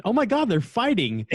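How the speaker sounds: high, panicky imitation of the wife; mock alarmed cry. Retelling how his wife reacted, mock panicked high cry. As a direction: pitch it up like you've heard a brawl downstairs. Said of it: "her and Lane came downstairs going".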